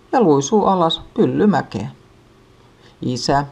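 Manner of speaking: usual speaking voice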